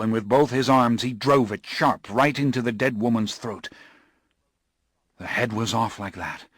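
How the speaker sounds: a shaken man reliving the horror; Morgan's haunted telling; Morgan, horrified storyteller. Acted. Morgan retells the staking.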